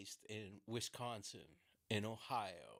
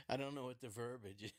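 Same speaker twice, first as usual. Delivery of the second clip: ordinary talk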